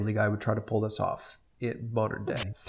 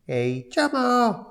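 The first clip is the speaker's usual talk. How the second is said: put-on higher, slow slurred drunk; 11 semitones higher, slower than usual. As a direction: drawl a silly name drunkenly. Drunk Peter naming dog.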